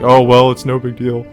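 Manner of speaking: mock-casual dismissal; mock nonchalance. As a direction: shrug it off in a put-on carefree way. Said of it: "she's acting like".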